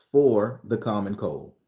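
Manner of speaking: usual speaking voice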